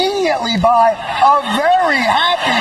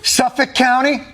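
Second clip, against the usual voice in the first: ordinary talk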